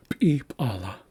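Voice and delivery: p'ala said slowly as p'ip'ala, two ejective Ps; plural form. Shown as a demonstration. Teacher shows the Haisla plural, doubled ejective P. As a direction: draw out "p'ala" as pi-pa-la with popped Ps. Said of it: "The next one here is plural".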